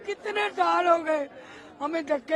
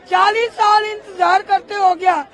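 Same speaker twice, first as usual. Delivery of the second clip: raised voice, pressing the grievance hard; real angry outcry; 3 semitones higher, 7 dB louder than usual. Real, not acted. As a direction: demand justice at full voice, outraged. Protesting the sentence as unjust.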